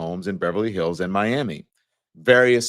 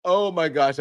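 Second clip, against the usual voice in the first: usual speaking voice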